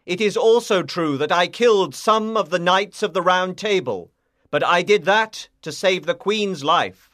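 loud, earnest defence; crying out. A narrator's reading. Lancelot answering the king from castle walls, loud voice. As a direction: project a firm plea across distance. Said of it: "he cried".

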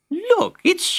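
high cry then slow, lightly voiced mother. A narrator's reading. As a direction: call out in glad surprise. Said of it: "A woman's voice cried".

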